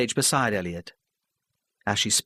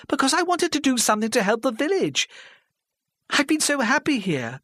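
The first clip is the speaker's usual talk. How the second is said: put-on higher, pleading older woman, halting; flustered older lady. Mrs Parry pleading with the hostile crowd, flustered. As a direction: stammer it out, desperate to be heard.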